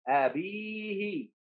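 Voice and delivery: quiet, stretched dictation of أبيه; drawn-out dictation. Shown as a demonstration. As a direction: hold the ee of أبيه, then let it fall. Slow dictation stretching the long vowel.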